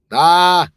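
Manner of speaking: sudden loud yell to get attention; test yell. Shown as a demonstration. Yelling toward the manager's office to test the booth. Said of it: "trying to see if she turns around if I yell".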